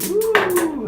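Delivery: sharp high yelp of cold shock; cold gasp. Real, not acted. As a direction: yelp as the icy water hits. Lowering into ice water.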